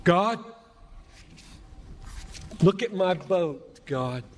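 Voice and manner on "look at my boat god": ordinary talk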